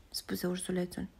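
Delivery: usual speaking voice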